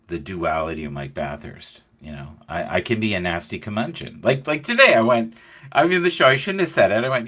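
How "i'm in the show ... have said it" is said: ordinary talk, nothing marked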